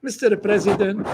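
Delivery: ordinary talk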